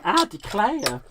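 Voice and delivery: a light Southern belle "Ah, declare" said high and airy; lightly voiced Southern lady on the porch; Southern belle drawl. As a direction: play a genteel lady fanning herself on the porch. Sipping iced tea on the porch.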